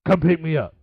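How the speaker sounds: loud blunt order; Robert Gibson quote. Retold or imitated. Veteran wrestler ordering the kids to fetch him, loud. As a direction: bark it like a gruff old pro. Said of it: "First words out of his mouth is".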